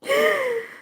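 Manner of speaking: short squealing laugh; real burst of laughter; high laugh. Real, not acted. Streamer laughing at chat, real high laugh.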